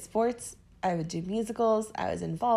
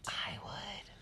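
demon voice; 14 dB quieter than usual. Pretend. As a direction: let a creepy demon speak it. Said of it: "What was that demon voice that just came out?".

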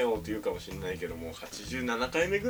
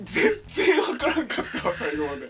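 exasperated wail; 11 semitones higher, 7 dB louder than usual. Real, not acted. Comedian confesses to partner he was lost, exasperated high wail. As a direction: wail it out with a laugh breaking in. Bewildered after the failed improvised bit.

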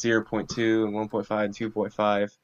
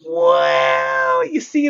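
wailing; 6 dB louder, much slower than usual. Real, not acted. Dramatic wail before explaining.

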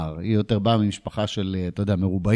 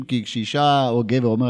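usual speaking voice

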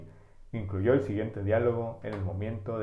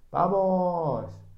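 high, louder "Vamos" like a cosmonaut; higher, louder launch call; eager launch cry; 9 semitones higher, 4 dB louder than usual. Acted. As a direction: shout it like a man taking off. Gagarin at launch.

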